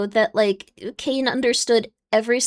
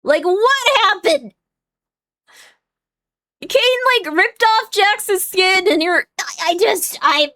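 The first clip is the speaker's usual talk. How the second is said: exasperated yelling; 2 semitones higher than usual. Real, not acted. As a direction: yell in disbelief at the chat. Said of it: "Sorry, I didn't mean to wake people up".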